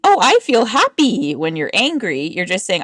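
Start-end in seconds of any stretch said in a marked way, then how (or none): 0.0-1.3 a touch of fake brightness; mock cheerful. Mocking fake happiness while angry, bright and high. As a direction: chirp it falsely happy. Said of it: "you're not saying".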